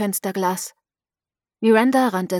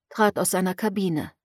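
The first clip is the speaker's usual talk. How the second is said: usual speaking voice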